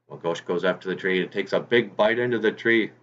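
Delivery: ordinary delivery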